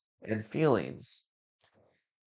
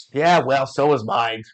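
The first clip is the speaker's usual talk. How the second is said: louder, fed-up comeback; exasperated retort; 2 semitones higher, 7 dB louder than usual. Acted. Frazzled dad firing back at the sage, loud. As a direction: toss it back loudly, frazzled.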